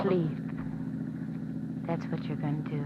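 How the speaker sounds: woman calming Joe until he sleeps; soothing. Acted. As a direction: hush him like a child. Woman soothing a frightened man.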